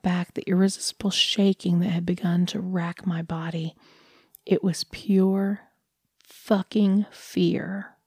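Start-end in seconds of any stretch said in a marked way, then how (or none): none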